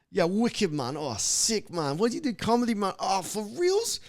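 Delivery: high-pitched, over-excited London lad; hammed-up London accent, climbing very high. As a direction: mimic a thrilled London bloke. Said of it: "these London dudes".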